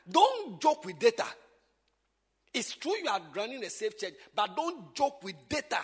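loud preaching at full force; shouting. Real, not acted. Pastor shouting instructions on records to teachers. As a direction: drive it home loudly. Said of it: "I'm shouting too much".

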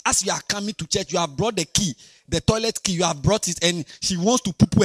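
ordinary talk, nothing marked

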